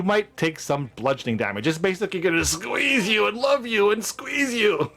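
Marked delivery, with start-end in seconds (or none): 2.5-4.9 higher, lilting smothering affection; mock cuddly. Game master teasing players about a squeezing carpet, mock cuddly. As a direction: hug them to death with words.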